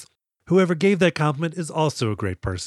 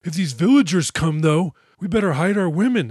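louder, higher-pitched hammed-up worry; exaggerated fretful alarm; mock scared; 4 semitones higher, 3 dB louder than usual. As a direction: imitate a panicking villager. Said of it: "scared now".

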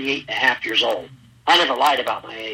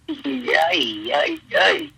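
sarcastic cheer; 10 semitones higher, much slower than usual. Retold or imitated. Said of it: "I was like".

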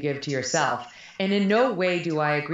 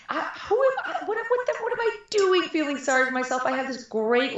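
much higher, laughing through it; laughing disbelief; 10 semitones higher than usual. Retold or imitated. Guest recalling her wake-up moment, laughing high. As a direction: laugh at your own silliness.

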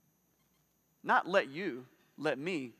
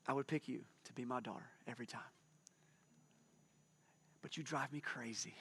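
soft, low, near-whispered tenderness; tender hushed voice; 3 semitones lower, 5 dB quieter than usual. Shown as a demonstration. Father to his daughter, hushed and tender. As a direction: murmur it close to her face. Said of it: "I might lower my voice like this".

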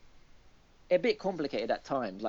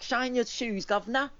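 high, chirpy imitation of a poor Victorian boy; cockney street kid; 10 semitones higher than usual. Describing the apprentice Luke to a friend, a high cockney kid voice. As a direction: pitch it up like a cheeky little urchin. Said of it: "he talks like that all the time".

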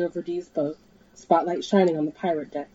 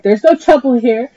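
higher, much louder screech; screech into mic; 6 semitones higher, 10 dB louder than usual. Acted. Dee screeches into her loudspeaker at the helicopter. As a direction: scream it over the loudspeaker. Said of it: "Dee screeched into her own microphone".

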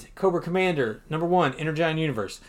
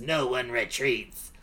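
throat-straining villain voice; 4 dB quieter than usual. Acted. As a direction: force it from the throat like a grim commander. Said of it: "that voice is gonna make my throat hurt".

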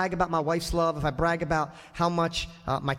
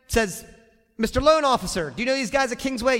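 eager donor; 5 semitones higher, 4 dB louder than usual. Acted. Acting donor at the bank.

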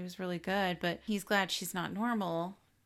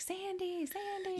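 calling out; 13 semitones higher, 5 dB quieter, slower than usual. Retold or imitated. Retelling Ellie chasing Sandy in the club, calling out. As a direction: call after someone in a high, small voice. Retold character calling after someone.

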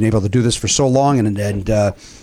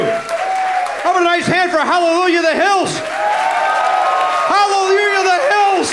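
announcer shout; slower than usual. Real, not acted. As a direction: hype the band to the crowd. Host announces band to crowd.

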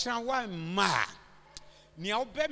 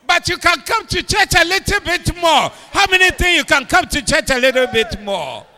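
preaching at full force; 7 semitones higher, 17 dB louder than usual. Real, not acted.

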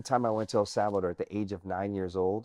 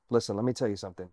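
ordinary talk

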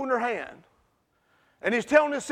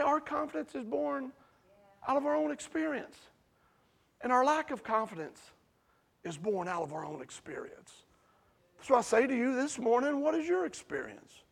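much softer, nearly whispered sermon; hushed preaching; 8 dB quieter than usual. Real, not acted. Preacher quietly asking the congregation about their experience, hushed. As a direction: draw them in with a hush.

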